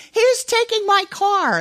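put-on high, loud child protest; little girl defending. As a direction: insist like an indignant toddler.

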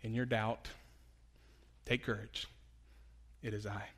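soft, low, gentle reassurance; whisper-close comfort to the doubter; the Holy Spirit echoing comfort, soft and low; quiet echo. Acted. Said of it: "echoes to us that same truth".

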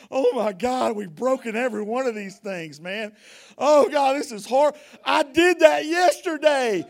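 acted crowd wailing over broken laws; anguished cry. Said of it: "they're weeping and they're crying".

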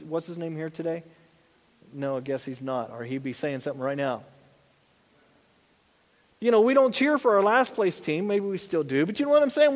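ordinary delivery

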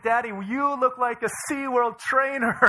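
put-on high, loud teasing child; laughing daughter. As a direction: imitate a girl delighted by her own joke. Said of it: "and she's laughing".

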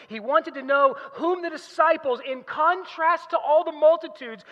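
loud, high, ringing sermon delivery; full-force preaching. Real, not acted. Preacher declaring God's answer at Jesus' baptism, full-force preaching. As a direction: proclaim it to the whole congregation.

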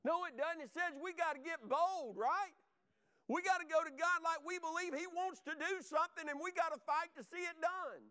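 rally them with a rising sermon cry; fervent preaching. Real, not acted. Preacher telling the church to get bold, fervent exhortation.

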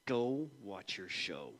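low, slow, held-back anger; seething through clenched teeth; gritted teeth. Retold or imitated. Angry dad to his son after the coffee spill, through gritted teeth. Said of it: "gritting my teeth".

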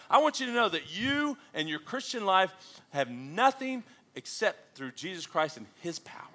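normal talking voice

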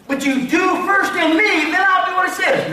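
high-pitched insistent counter-demand; bargaining demand. Acted. Voices people bargaining with Jesus.

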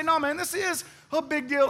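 high, urgent acted protest; insistent. Acts insisting to the driver.

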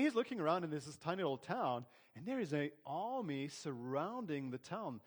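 usual speaking voice

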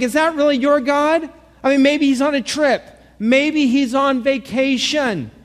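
Elijah taunting the prophets of Baal, high and scornful; thick sarcasm. Retold or imitated. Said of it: "The sarcasm is thick".

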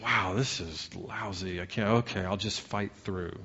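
soft, low grumble; put-upon servant muttering to himself; grumbling complaint. Acted. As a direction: complain quietly, resigned to the chore. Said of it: "grumbling and complaining servant Jesus".

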